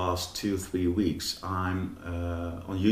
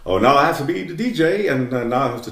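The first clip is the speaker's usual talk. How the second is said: loud, fast, higher-pitched mock DJ patter; put-on DJ voice; 6 semitones higher, 10 dB louder, faster than usual. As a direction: ham up a radio DJ straining to be funny. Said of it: "now you put on a voice and an act".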